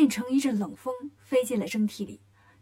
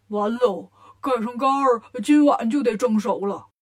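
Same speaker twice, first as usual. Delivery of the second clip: lightly voiced doomed pig; despairing Bajie; 4 dB louder than usual. A narrator's reading. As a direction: lament as if all is lost. Bajie despairs as lid closes. (猪八戒绝望地哀叹)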